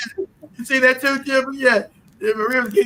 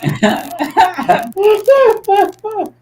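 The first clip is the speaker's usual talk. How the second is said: high, loud burst of laughter; laughing at own voicing; 8 semitones higher, 4 dB louder than usual. Real, not acted. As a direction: crack up loudly. Laughing at the boast.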